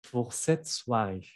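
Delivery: ordinary delivery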